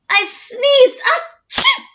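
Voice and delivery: huge high acted sneeze; hammed-up achoo; big sneeze. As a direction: blow out a giant sneeze. Wolf sneezes the house down.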